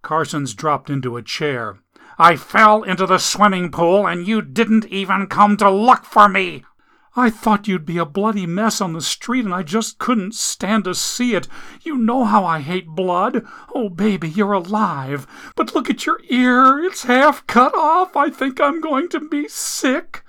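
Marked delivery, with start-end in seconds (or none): 2.2-6.6 higher, louder reproach; hurt man accusing his girlfriend; bitter accusation. Acted. Jack accuses her. 7.2-20.2 high, fussy whine rising to disgust; whiny squeamish woman. Acted. Amelia whining, then nauseous.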